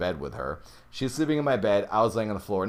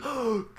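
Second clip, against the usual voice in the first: scared gasp. Retold or imitated. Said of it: "so scared".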